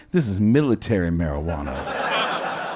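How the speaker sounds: very low imitated voice. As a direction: drop deep for the rapper's answer. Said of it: "I'm not gonna make this a racist voice".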